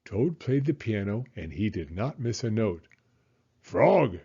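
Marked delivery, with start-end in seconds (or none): 3.6-4.2 a touch louder, calling the name. Acted. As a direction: call out to him in the dark. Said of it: "cried Toad".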